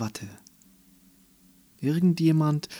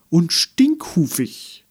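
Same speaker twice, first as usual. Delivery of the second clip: slow, loud and higher, like a horse neighing; whinnying; 6 semitones higher, 8 dB louder, slower than usual. Acted. The unicorn proudly shouting his insult in a neigh. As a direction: stretch the word out in a loud whinny. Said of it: "Meckermähne wieherte".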